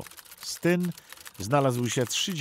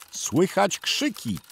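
plain reading voice

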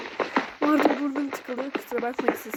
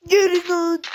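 loud, higher snapping shout; 5 semitones higher, 8 dB louder than usual. Real, not acted. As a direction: yell it at the mobs. Shouting at mobs. (yaratıklara bağırarak)

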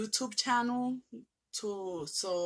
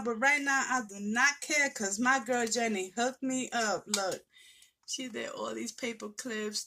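ordinary talk, nothing marked

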